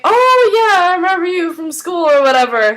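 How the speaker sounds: high, loud mock-friendly oh yeah; delighted recognition, imitated. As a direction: act out suddenly remembering someone. Voices sudden friendly recognition.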